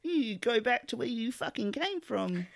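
hammed-up bigot yelling; mock bigot rant. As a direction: play an angry bigot ranting. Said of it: "wrong rhetoric".

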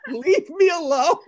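laughing through the words; can't stop laughing. Real, not acted. Host cracking up to cohost, laughing.

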